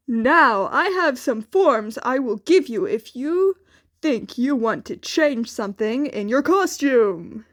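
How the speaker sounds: All Might offering costume forms, loud and hearty. A narrator's reading. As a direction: project like a cheerful hero teacher. All Might voiced at length.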